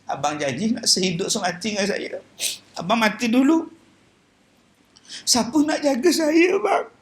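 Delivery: pleading, rising to a high cry on bang; mock widow's lament. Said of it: "Kadang-kadang dia berbisik". (isteri merayu suami yang mati, meratap)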